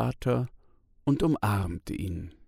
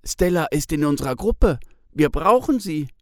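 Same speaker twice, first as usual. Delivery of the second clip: put-on faster, louder, higher boy; insistent child; 5 semitones higher, 7 dB louder than usual. A narrator's reading. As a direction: stand up for your friend. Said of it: "Pitt sagt". (Pitt verteidigt Stella laut und schnell)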